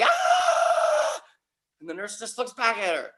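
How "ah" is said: long, very high-pitched imitated scream; horrified scream. Sister watching baby go out the window, horrified scream. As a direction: shriek out one drawn-out "ah". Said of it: "my sister's like".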